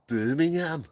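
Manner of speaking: "Birmingham" said high in a Brummie accent; a quick Brummie take on "Birmingham". Shown as a demonstration. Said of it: "I can do Birmingham".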